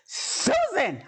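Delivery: high call of her name across the street; a mom hollering down the block; mother calling out. Retold or imitated.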